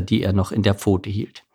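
usual speaking voice

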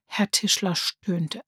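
plain reading voice

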